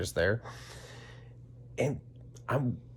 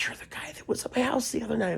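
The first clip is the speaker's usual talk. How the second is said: high, hushed whisper; higher-pitched whispered reveal; 12 semitones higher than usual. Retold or imitated. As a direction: lean in and whisper it like a secret. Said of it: "And she's like".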